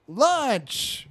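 put-on high, proud one-word kid tagline; imitated child's call. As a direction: announce it like a boy jumping off a swing. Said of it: "starts saying".

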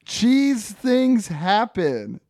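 high, loud, very drawn-out pun; punning pepperoni Ernie. Acted. Ernie consoles Frank with a pun, hammed-up. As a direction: stretch every word of the punchline. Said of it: "Ernie goes".

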